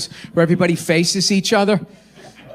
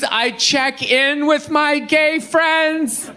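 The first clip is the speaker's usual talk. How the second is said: usual speaking voice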